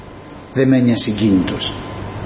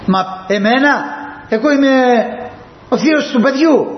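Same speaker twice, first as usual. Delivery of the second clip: much higher, affronted protest; indignant relative; 10 semitones higher than usual. Acted. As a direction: play an offended uncle being shown the door. Relative protests being thrown out.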